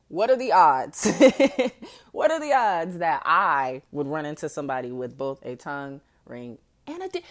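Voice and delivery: normal talking voice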